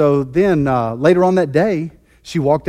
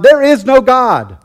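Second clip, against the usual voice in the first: slow, louder, higher yell; yelled retort; 6 semitones higher, 4 dB louder than usual. Retold or imitated. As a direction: shout it over the fence at her. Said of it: "he would just yell out".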